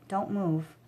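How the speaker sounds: hushed self-warning; whispered to self. A narrator's reading. Boy hiding from goons, hushed warning to himself. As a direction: barely breathe the words. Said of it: "I told myself".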